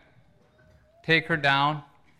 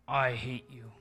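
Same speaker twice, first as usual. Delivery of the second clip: hushed hatred through clenched teeth; 8 dB quieter than usual. Retold or imitated. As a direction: seethe it very softly through your teeth. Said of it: "spoke through her teeth".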